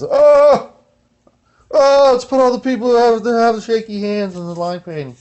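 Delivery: high, loud mock outburst easing off; mock outcry. Complaining about shaky hands painting the lines, mock outcry. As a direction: cry out high in mock dismay, then settle. Mocks a badly painted factory batch.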